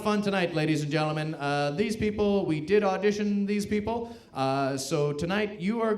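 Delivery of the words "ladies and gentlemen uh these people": ordinary delivery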